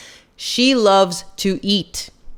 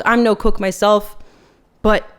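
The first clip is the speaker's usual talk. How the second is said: usual speaking voice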